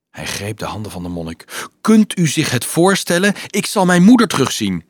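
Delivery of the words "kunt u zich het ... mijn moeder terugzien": excited. Acted. Boy overjoyed going home.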